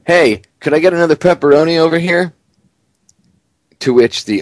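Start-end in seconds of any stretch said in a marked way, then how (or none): 0.1-2.3 higher, casual mayor calling for more pizza; mock Bloomberg. Mayor calls out to the owner for pepperoni, mock voice. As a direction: toss the order across the room, entitled. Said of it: "this is Bloomberg, to the owner".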